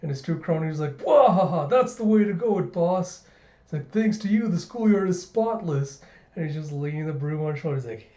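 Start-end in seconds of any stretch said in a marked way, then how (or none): 1.0-1.7 hearty mock henchman laugh, loud; a bragging sidekick's belly laugh; crony laugh. Henchman cheering his boss with a loud laugh. 1.7-3.2 higher, louder fawning crony; sidekick praise. Retold or imitated. Sidekicks flattering their boss, fawning and loud. 3.9-6.0 higher, louder fawning crony; sidekick praise. Retold or imitated. Sidekicks flattering their boss, fawning and loud.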